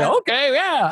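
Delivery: high, game mock agreement; imitated easygoing agreement. Imitating friend going along with drinking.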